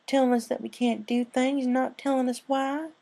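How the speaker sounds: high whiny filly complaint; complaining filly. Acted. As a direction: grumble in a high kid voice. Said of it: "the young yellow filly complained".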